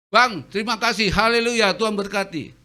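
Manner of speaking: shower the driver with blessings; cheery blessing. Retold or imitated. Preacher blessing the bus driver, loud and cheery.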